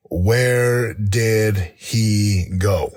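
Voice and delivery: WHERE DID HE GO drawn out very slowly; deliberate, weighty question. Retold or imitated. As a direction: spell out each word with great weight. Repeats question to himself for weight.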